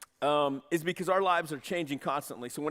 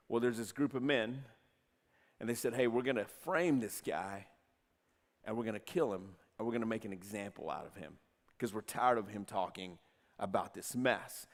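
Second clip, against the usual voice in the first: low conspiratorial; 7 semitones lower, 7 dB quieter than usual. Acted. Plotting men, low quiet telling.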